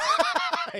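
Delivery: very high, loud put-on giggle; silly high laugh. Clueless character laughs off the question, high silly giggle.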